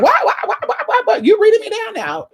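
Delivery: best friend shrieking what what what in disbelief; indignant friend being read; high-pitched outraged friend. Retold or imitated. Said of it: "she's like".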